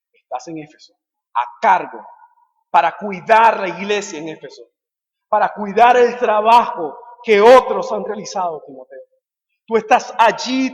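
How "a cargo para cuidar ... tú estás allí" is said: raised, emphatic charge to Timoteo; strong pulpit exhortation; forceful preaching. Real, not acted. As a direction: preach it as a firm commission.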